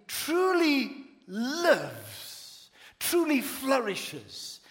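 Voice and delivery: drawn-out, weighty proclamation; emphatic preaching. Real, not acted. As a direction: savour each word of "truly lives, truly flourishes". Preacher dwells on added words.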